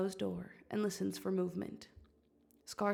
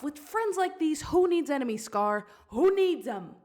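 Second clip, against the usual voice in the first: scolding, getting louder; angry yelling; 6 semitones higher, 5 dB louder than usual. Acted. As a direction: mom tearing into Scar. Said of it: "As Mom yells".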